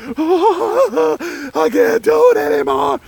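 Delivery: high wailing sob voice; mock crying wail. Retold crying soldier quitting.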